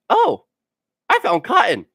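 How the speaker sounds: excited discovery. Retold or imitated. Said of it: "I was like".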